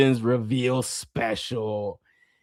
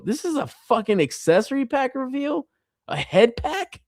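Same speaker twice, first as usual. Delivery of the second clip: high-pitched disbelieving question; incredulous; 13 semitones higher than usual. Retold or imitated. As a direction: question it as if you can't believe the letdown. Incredulous at letdown reveal.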